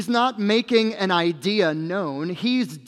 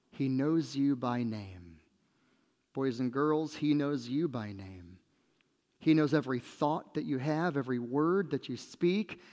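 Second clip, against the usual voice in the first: hushed, lower and softer; tender assurance; 4 semitones lower, 7 dB quieter than usual. Real, not acted. Preacher assuring boys and girls God knows them, tender. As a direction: bring it down to an intimate, earnest address.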